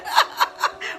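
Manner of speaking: airy, unvoiced burst of laughter; breathless giggle. Real, not acted. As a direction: laugh out the emotion. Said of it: "I cry".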